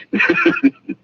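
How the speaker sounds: a forced, high-pitched titter; nervous high giggle. Acted. Doctor laughs off his prying question, a nervous high titter.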